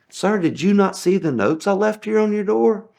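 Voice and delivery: pointed, incredulous question; indignant. Retold or imitated. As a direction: push back at him. Confronting rude customer.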